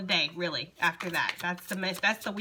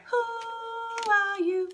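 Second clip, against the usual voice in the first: very high, very slow hooting spirit voice, louder on the repeated who; voicing the spirits; 14 semitones higher, 5 dB louder, much slower than usual. Reader voicing Spirit asking who someone is, high ghostly hoot. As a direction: pitch way up and stretch it like a ghostly owl. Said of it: "That's what they're saying".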